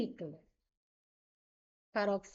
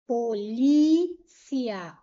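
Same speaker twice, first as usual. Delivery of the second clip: "polícia" slowly, LI held long and much louder; stress on LI; 8 dB louder, much slower than usual. Shown as a demonstration. Teacher showing the tonic syllable, "polícia" with a long, loud LI. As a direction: punch and stretch the li in "polícia". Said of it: "o li é a sílaba tônica".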